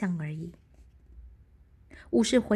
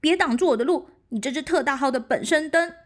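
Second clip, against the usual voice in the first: loud, fast defiant yell; shout; 5 semitones higher, 7 dB louder, faster than usual. A narrator's reading. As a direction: roar it at the dragon. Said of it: "用他能发出最大的声音大吼一声".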